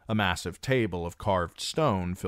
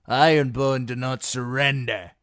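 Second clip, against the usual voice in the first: louder, proud rough voice; defiant ironman; 6 semitones higher, 7 dB louder than usual. Acted. An Ironborn defying Reek, loud and proud. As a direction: spit the refusal. A third voice defies.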